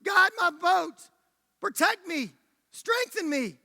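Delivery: high-pitched, pleading declaration; fervent prayer. Acted. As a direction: cry out each petition with conviction. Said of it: "You gotta choose to say".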